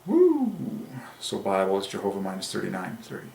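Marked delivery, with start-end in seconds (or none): 0.0-0.5 high ecstatic woo; sudden delighted whoop; joyful whoop. Real, not acted. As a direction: let out a thrilled cheer. Ecstatic over numerology discovery.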